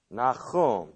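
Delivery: Nahum said nah-KHOOM; throaty kh in the middle of Nahum; Hebrew pronunciation. Shown as a demonstration. Bible teacher explaining the word for comfort, Hebrew pronunciation. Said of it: "that's how his name is pronounced in Hebrew".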